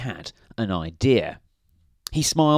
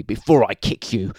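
snapped; 5 dB louder than usual. A narrator's reading. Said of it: "snapped Mister Grumpy".

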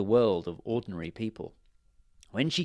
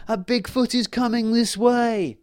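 panicked shout; 12 semitones higher, 6 dB louder than usual. A narrator's reading. Fairy warns the wood of a Bigfoot: very high, loud shout. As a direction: yell to send everyone running. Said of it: "he shouted".

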